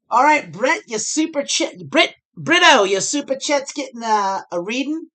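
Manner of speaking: high, loud and bright-eyed read-out call; excited announcement. Real, not acted. Streamer calling out a viewer's super chat, excited.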